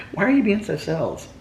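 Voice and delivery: high cooing question; teasing pet talk. Real, not acted.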